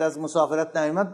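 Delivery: ordinary talk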